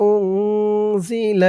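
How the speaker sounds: أنزل slow with the noon hidden in a nasal hum; nasalized noon. Shown as a demonstration. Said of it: "ইকফা গুন্না". (أنزل এ নুন গুন্নাসহ)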